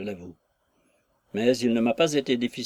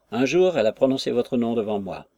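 usual speaking voice

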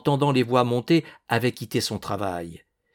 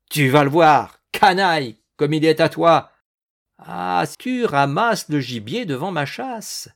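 loud, raised voice of outrage; furious threat; 5 semitones higher, 7 dB louder than usual. Acted. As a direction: roar insults at the poacher. Hunter threatens the farmhand.

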